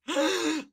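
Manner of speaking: a sharp, high gasp of disbelief; surprised gasp. Retold or imitated. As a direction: gasp like you just heard your name called. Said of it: "and I'm just like".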